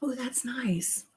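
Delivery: breathy whispered approval after a sip. Real, not acted. Sipping cider, whispering to her husband. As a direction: hush it out, savoring the taste.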